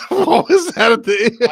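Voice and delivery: high squeal of laughter; laughing. Real, not acted. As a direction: ask it while cracking up. Cracking up after the bit.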